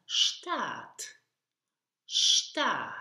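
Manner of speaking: Stadt said slowly, sht start, hard final t; model pronunciation. Shown as a demonstration. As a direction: Stadt with sh and a crisp t at the end. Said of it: "the final D is pronounced here like an T".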